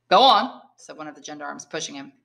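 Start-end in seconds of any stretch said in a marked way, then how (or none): 0.1-0.6 louder, higher shove of a command; guard orders the prisoner forward; gruff order. Acted. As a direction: bark it as you push. Gendarme pushes the prisoner.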